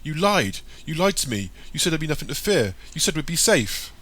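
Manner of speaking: high, accusing outburst; angry scream. A narrator's reading. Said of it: "angrily screams at Hoto".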